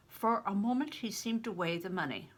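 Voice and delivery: plain reading voice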